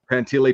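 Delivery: Pantile said as pan-TILLY; mispronounced surname. Shown as a demonstration. Guest listing versions of his name, Pantile said pan-TILLY. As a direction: give Pantile a short "tilly" ending. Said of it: "Nobody actually knows".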